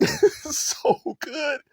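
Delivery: high-pitched words through a laugh; laughing praise. Real, not acted. Host cracking up after raving about a song, laughing praise.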